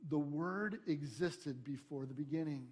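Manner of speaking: ordinary talk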